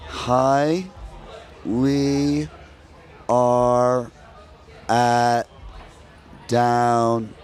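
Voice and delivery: one word at a time, long gaps between; mock a dim-witted Southerner, drawing out every word; mock slow talker. American mocking Southerners' talk, painfully slow. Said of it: "they're slow".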